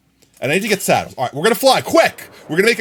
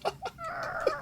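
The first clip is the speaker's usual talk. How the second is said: high laugh; 15 dB quieter than usual. Real, not acted. After the mock plea.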